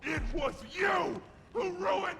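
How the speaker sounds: screaming at the top of the voice; angry shout. Acted. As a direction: roar it in fury. Monster shouts accusation.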